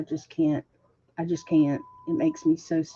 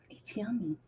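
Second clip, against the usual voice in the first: whisper; 4 semitones higher, 12 dB quieter than usual. Real, not acted. Savoring champagne thought.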